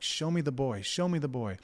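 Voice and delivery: mild mock yell. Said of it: "And I probably yelled that".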